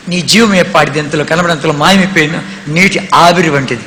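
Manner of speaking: normal talking voice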